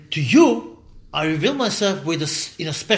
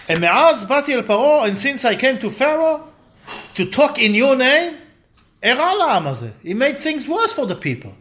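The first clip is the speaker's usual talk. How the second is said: high, louder pleading protest; indignant complaint; 7 semitones higher, 4 dB louder than usual. Retold or imitated. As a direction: press God with rising accusation. Moses complaining to God.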